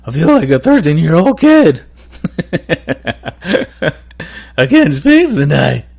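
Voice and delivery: cracking voice, jumping up high. Real, not acted. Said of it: "I'm losing my voice here".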